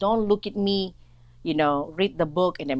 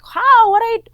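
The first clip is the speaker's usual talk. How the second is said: high, loud imitated shock; mock outburst; 15 dB louder, faster than usual. Woman acting out the reaction he didn't have, mock shock. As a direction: exaggerate a shrieked startled challenge.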